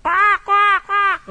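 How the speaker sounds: high squawking quack, far above the speaker's voice; a preacher's comic bird squawk; raven imitation. Shown as a demonstration. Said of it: "let me see if I can do my raven imitation".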